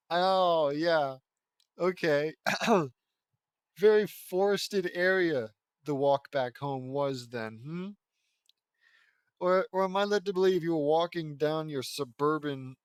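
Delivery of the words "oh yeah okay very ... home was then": usual speaking voice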